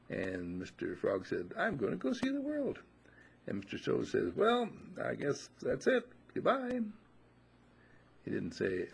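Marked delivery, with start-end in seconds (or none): 1.6-2.8 high, bright-eyed youngster voice; young frog announcing his adventure, high and eager. Acted. Young frog answers the toad. 4.4-6.8 high put-on voice, shrugging goodbye; resigned old toad. Old toad bids farewell.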